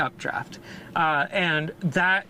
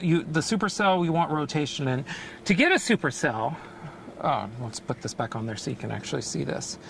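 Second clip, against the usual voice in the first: normal talking voice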